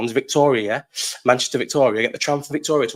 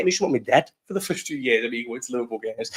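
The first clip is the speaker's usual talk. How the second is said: ordinary delivery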